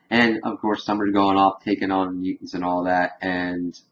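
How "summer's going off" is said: ordinary talk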